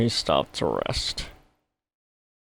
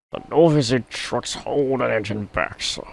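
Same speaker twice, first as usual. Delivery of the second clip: complaining; 9 semitones higher, 4 dB louder than usual. A narrator's reading. Gordon complains.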